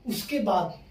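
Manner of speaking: ordinary talk, nothing marked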